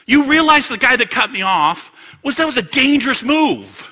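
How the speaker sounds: high, loud self-righteous rant; indignant complaint. Acted. Cut-off driver justifying anger, indignant and loud. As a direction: protest as the wronged driver, loudly. Acts out road-rage justification.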